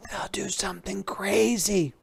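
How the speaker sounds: hushed unvoiced whisper. Page warns the king in a whisper. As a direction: whisper the warning so no one hears. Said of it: "whispered Bartholomew".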